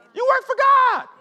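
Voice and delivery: high, full-throated preaching cry; preaching shout. Real, not acted. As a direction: blast it out to the congregation.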